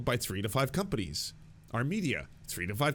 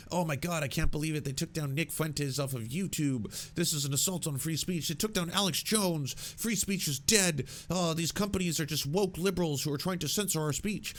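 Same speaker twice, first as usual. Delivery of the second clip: higher, whiny outraged parody; mocking free speech warriors; 6 semitones higher than usual. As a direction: ham up their offended panic. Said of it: "the free speech warriors were talking".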